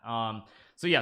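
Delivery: ordinary delivery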